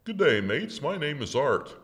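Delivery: put-on Australian accent. Reader voicing the elderly Australian gent introducing himself, mild Australian accent. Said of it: "my best imitation of an Australian accent".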